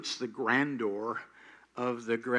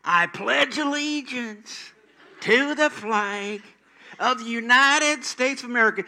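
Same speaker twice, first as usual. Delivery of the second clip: put-on old aunt reciting; 9 semitones higher, 5 dB louder than usual. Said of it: "and she goes".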